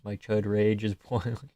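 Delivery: ordinary talk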